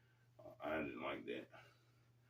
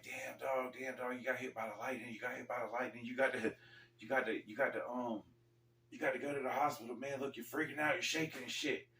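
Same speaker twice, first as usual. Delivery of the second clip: higher, urgent voice of worried coworkers; 5 semitones higher, 5 dB quieter than usual. Retold or imitated. Coworkers urging him to the hospital, higher and urgent. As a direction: blurt it out like panicked coworkers. Coworkers panicking after lightning strike.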